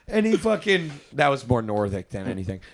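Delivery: usual speaking voice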